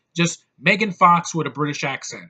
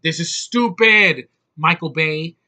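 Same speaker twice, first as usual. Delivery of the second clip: mock outrage; 3 semitones higher, 7 dB louder, slower than usual. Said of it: "I'm like".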